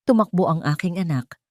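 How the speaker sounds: plain reading voice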